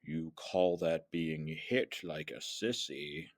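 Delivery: low, slow villain voice; quiet, drawn-out dark lord; Darth Sidious. Acted. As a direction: sneer in a low, soft sinister voice. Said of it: "Darth Sidious then came out of the shadows".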